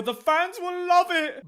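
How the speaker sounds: high-pitched, gleeful mocking pitch; mock enthusiasm. Hollywood exec sure the fans will love it, mock enthusiasm. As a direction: imitate a clueless executive selling it.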